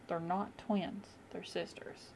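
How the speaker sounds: slow and hushed on the saying, dropping to a whisper; hushed old saying. Pretend. Vlogger to viewers, quoting a saying about her brows, slow hushed to a whisper. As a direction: let the saying slow down, then breathe the last word out. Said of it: "there's an old saying".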